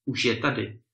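ordinary delivery, nothing marked

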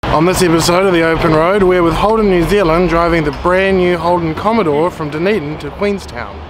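loud, upbeat presenter voice; show host intro. Acted. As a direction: announce the episode like a TV host.

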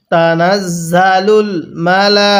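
slow chant of তানাজ্জালুল মালাইকাতু; tajweed chant; slow recitation. Shown as a demonstration. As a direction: recite with held vowels. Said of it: "কিভাবে শিখব".

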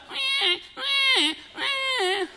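high-pitched piglet whine; thin, high bleats, drawn out like a baby pig; whining pig. Retold or imitated. Telling friends about a baby pig, imitated whining. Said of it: "it was whining".

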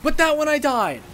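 high, loud indignant yell; raging at the game's inconsistency; furious shout. Real, not acted. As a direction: bellow it at the game. Outraged at inconsistent hitbox.

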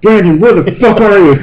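high, loud exasperated call; retold yell for a missing friend. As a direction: shout his name into the dark. Yelling for missing Brandon.

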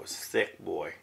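usual speaking voice

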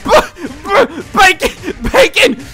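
high, loud, stammering shout; excited yell. Real, not acted. Gamer shrieking at the bacon on screen. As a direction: blurt it out in a frenzy.

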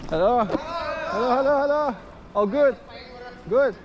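high, bright hail from a distance; calling out hello. Real, not acted. Vlogger hailing workers across the pitch, high calling voice. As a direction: sing out the hellos so they carry.

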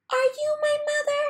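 very high, quick, near-crying chick; tearful baby bird. A narrator's reading. Baby bird begs the cow, high and near tears. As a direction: plead with a catch in the voice. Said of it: "he said to the cow".

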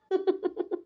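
a bashful little giggle, soft and short; shy giggle. Acted. Shy giggle after confessing love to her fiancé. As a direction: laugh it off shyly after saying I love you.

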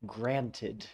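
usual speaking voice

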